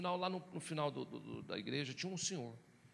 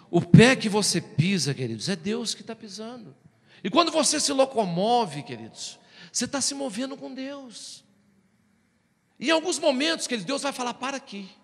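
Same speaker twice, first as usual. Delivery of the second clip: loud, raised, emphatic sermon delivery; forceful preaching; 7 semitones higher, 11 dB louder than usual. Real, not acted. Preacher telling the congregation they move with God, forceful preaching. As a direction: drive each phrase home at full voice.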